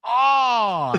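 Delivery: winded high-pitched "oh" from an elbow to the sternum; pained yelp. Retold or imitated. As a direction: let out a sharp high "oh" as if elbowed in the chest. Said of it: "like this".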